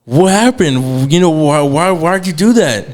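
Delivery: drawn-out, high-pitched demand for answers; bewildered questioning. Retold or imitated. Imitating the man he almost hit questioning him, bewildered.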